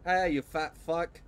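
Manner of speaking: slow taunting yell from above; calling down a hallway. Real, not acted. I don't know if they could even hear me.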